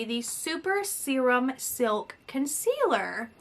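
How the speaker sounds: ordinary talk, nothing marked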